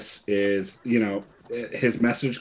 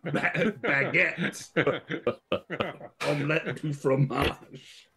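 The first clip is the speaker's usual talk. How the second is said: higher, hammed-up French, laughing; higher cartoon French voice through giggles; mock French accent; 6 semitones higher, slower than usual. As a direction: ham up a silly Monty Python Frenchman. Mocking French, Monty Python jokes.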